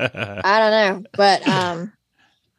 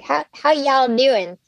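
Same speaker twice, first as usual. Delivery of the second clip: drawled, higher imitation of a Southern accent; mock Southern accent; 5 semitones higher, slower than usual. Guest mocking people who impersonate Southerners, exaggerated Southern accent. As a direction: imitate an outsider faking Southern, slowly. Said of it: "when people impersonate Southerners".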